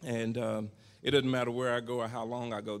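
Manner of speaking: ordinary talk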